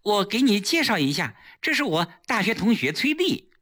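high, lively introduction; eager greeting. A narrator's reading. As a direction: beckon someone over eagerly. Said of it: "季嫣然忙招呼着胡威".

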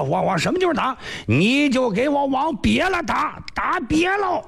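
strongman bellowing to his elder brother; booming strongman. Acted. Yu Heng boasts of his strength.

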